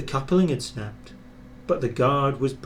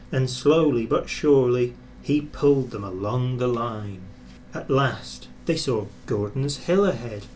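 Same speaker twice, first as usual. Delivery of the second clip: ordinary delivery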